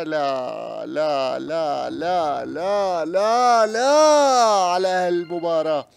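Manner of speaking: loud rising cries of disbelief; excited repeated no. Real, not acted. Repeated triumphant no's. (لا لا بحماس متصاعد)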